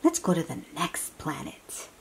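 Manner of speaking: normal talking voice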